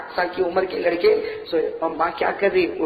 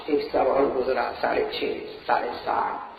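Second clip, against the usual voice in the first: soft counting; 7 semitones lower, 6 dB quieter than usual. Acted. Counting the years softly.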